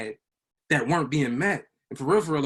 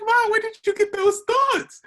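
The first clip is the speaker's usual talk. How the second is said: much higher, slow, astonished plea; telling Mom he cannot believe her thoughts, high and stunned; stunned disbelief; 7 dB louder than usual. Retold or imitated. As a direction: ask it in utter shock. Retelling his stunned reply to mom.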